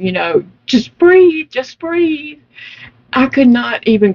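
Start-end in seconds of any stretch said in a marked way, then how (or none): 0.7-2.4 much higher voice for a coaching nurse; imitated nurse coaching. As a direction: imitate a nurse coaxing a patient to breathe. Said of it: "the nurse is saying".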